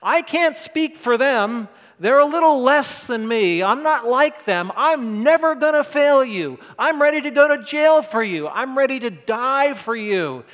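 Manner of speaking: loud, high, cocky boast; brash loud bragging disciple; bravado. Retold or imitated. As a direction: puff up like Peter swearing loyalty. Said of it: "He's all bravado".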